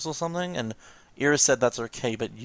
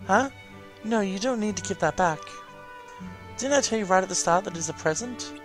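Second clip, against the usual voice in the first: higher little companion voice, gently insistent; coaxing familiar character; 9 semitones higher than usual. Acted. Familiar urging the player to keep the present, coaxing. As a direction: coax them as the helpful game mascot.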